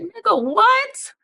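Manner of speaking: high, slow disbelieving squeal; incredulous. Retold or imitated. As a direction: stretch out the disbelief. Incredulous reaction.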